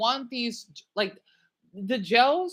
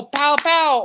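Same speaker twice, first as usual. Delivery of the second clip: loud, higher mock street shouts; 6 semitones higher, 8 dB louder than usual. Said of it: "I don't talk like that".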